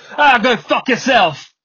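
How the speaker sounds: imitated English accent, loud, high and drawn out; punchy, slow mock English accent; Idris Elba. Podcaster showing how the accent improves a curse, mock English accent. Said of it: "when Idris Elba says it, it comes off as".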